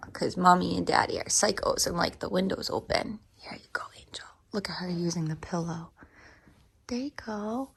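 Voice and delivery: soft unvoiced whisper. Real, not acted. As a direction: hush it near the sleepy dog. Whispering to the dog.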